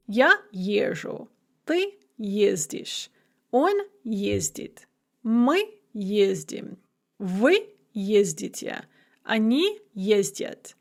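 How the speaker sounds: each form of ездить slow and spaced; slow conjugation drill. Shown as a demonstration. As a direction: recite the present of ездить for learners. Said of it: "notice that the d becomes a zh".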